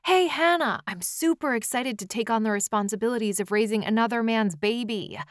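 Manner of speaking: loud, high greeting into a fast gush; sarcastic pretend excitement, rattled off; mock enthusiasm. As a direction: imitate a boyfriend being absurdly thrilled. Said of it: "what I'm pretty sure he's not going to say".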